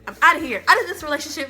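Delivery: loud, dramatic breakup declaration; dramatic exit line. Retold or imitated. Walking out on her boyfriend, loud dramatic declaration. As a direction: slam the door with the words. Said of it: "Like, I was so dramatic".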